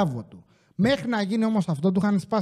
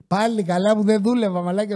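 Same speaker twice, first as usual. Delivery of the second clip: usual speaking voice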